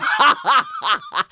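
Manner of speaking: high, loud cackling laugh; hammed-up derisive laughter; soldier's mocking laughter. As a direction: burst out in shrieking ha-ha-has. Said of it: "the soldier started laughing".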